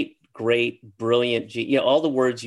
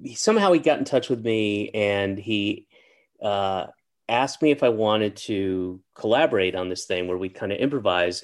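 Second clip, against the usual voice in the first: ordinary talk, nothing marked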